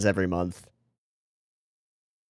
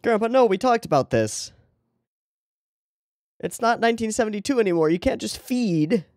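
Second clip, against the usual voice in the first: high scolding grandchild voice; 12 semitones higher than usual. Pretend.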